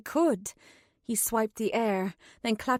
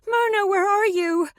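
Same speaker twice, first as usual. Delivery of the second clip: high, louder desperate yell; 10 semitones higher, 5 dB louder than usual. A narrator's reading. Katrin yells for Myrna amid the fighting, louder. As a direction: shout for help over the chaos. Yelling for help in chaos.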